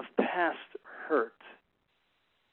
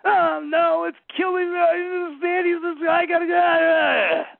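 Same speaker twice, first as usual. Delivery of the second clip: much higher, louder hammed-up child wail; 13 semitones higher, 10 dB louder than usual. Kids with scraped knees wailing to their father, hammed-up and much higher. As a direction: ham up a hurt kid howling that it's dying. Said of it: "And their response".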